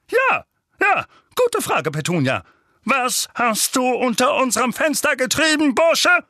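high, loud accusing demand; strained, worked-up interrogation; angry uncle. A narrator's reading. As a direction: grill the boy furiously.

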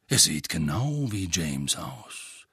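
low, slow thoughtful wizard; deep, deliberate voice. A narrator's reading. Bald wizard remarking on Harry's looks, deep and deliberate. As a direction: say it low and unhurried. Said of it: "Er hatte eine tiefe, bedächtige Stimme".

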